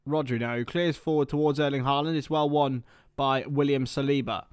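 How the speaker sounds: building match commentary, rising into the kick-off; live play-by-play. Acted.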